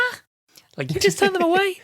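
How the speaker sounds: high, loud disbelieving squeak; incredulous squeak. Retold or imitated. Podcaster mocking her own reaction, high incredulous squeak.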